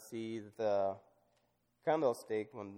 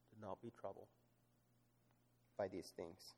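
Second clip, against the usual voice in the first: much softer, gentle scripture voice; hushed reading; 3 semitones lower, 15 dB quieter than usual. Acted. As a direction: say it softly, as comfort.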